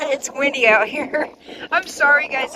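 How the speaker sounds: shouting over wind. Real, not acted. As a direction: call out over gusts. Windy day, loud wind on camera.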